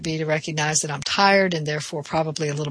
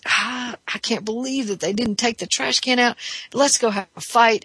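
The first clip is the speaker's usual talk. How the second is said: higher, exasperated grumble at the trash; aggravated inner voice; 7 semitones higher than usual. Retold or imitated. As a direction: act out the irritated thought, pitched up. Inner voice of irritated, tired partner.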